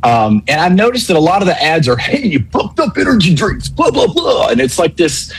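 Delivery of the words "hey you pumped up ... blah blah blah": mock hyped-up ad announcer, much higher; high, shouty cheesy radio voice. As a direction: ham up a pumped energy-drink ad read. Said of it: "really cheesy radio voice".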